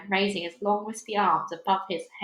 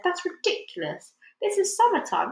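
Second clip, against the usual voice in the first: much higher, mocking disbelief; scoffing; 10 semitones higher than usual. A narrator's reading. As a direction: sneer at the silly idea.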